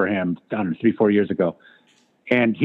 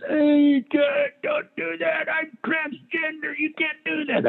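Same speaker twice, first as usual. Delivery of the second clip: mock crying plea; slower than usual. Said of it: "Joe starts crying".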